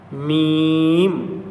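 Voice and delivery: میم hummed long through closed lips; drawn-out mim demo. Shown as a demonstration. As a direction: hold میم slowly with both lips together. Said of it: "دونوں ہونٹ ملتے ہیں".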